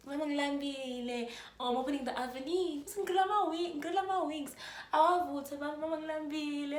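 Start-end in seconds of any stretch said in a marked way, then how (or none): 0.1-1.3 put-on high, pleading child-to-mom whine; babyish whining. Hungry speaker to her mom at home, babyish whining. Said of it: "And I'm such a baby". 2.5-6.8 put-on high, pleading child-to-mom whine; babyish whining. Hungry speaker to her mom at home, babyish whining. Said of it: "And I'm such a baby".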